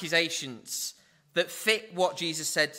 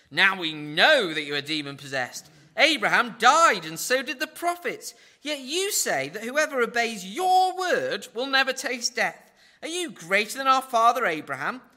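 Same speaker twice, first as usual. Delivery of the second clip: indignant accusation; 5 semitones higher, 6 dB louder than usual. Retold or imitated. Crowd accusing Jesus angrily.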